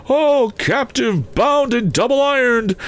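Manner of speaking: very high, slow ghostly cry; lamenting phantom. A narrator's reading. Phantom crying out over its chains, anguished high wail. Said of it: "cried the phantom".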